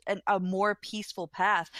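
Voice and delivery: ordinary delivery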